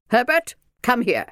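high, loud stern order; commanding. A narrator's reading. Minder calls Bert to her, commanding. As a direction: summon the child loudly.

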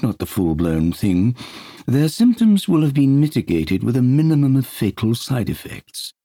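lightly voiced pompous doctor; Harley Street consultant. Peter playing consultant to Dunbar, pompous. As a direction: pronounce it like a grand specialist. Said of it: "now a Harley Street consultant".

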